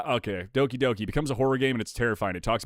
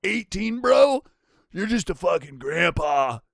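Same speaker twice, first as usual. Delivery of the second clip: drawn-out imitation of a twelve-year-old, starting high; mock brain-rotted kid; 4 semitones higher, 5 dB louder than usual. Streamer mimicking twelve-year-olds on old age, mock kid voice. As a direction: mimic a dismissive preteen, stretching the words. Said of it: "twelve-year-olds out here have been so brain rotted".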